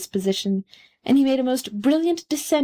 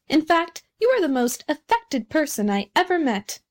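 higher-pitched emphatic retort; crying out; 6 semitones higher than usual. A narrator's reading. Bengal Light tells the Rocket he is affected, crying out. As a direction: exclaim it, pitch lifted. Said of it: "cried the Bengalite".

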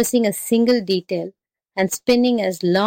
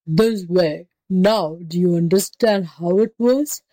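exasperated beast voice; tiger losing patience; slower than usual. A narrator's reading. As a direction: demonstrate it as a tiger at the end of its patience. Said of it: "the tiger lost patience".

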